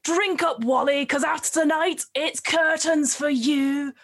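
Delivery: goon's sneering threat. Acted. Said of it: "They're like".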